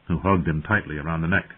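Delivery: usual speaking voice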